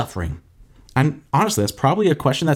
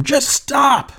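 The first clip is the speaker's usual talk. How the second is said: high, strained mock yell; mock scream; 12 semitones higher than usual. Man screaming at a storm to stop, acted high yell. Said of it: "You ever scream at a storm".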